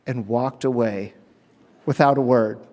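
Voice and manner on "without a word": ordinary delivery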